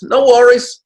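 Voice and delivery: put-on Aussie accent. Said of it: "your best Aussie accent".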